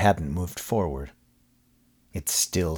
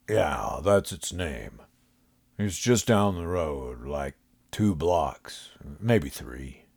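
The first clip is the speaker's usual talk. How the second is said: homeless man voice; 2 semitones lower, slower than usual. A narrator's reading. Homeless man, slow and lazy.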